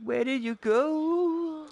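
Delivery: loud, high, drawn-out call; high slow call. Pretend. Rider shouting after a vanished teammate, loud high call.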